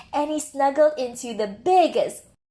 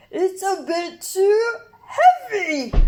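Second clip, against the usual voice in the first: straining bear cub; 8 semitones higher, 4 dB louder, slower than usual. Acted.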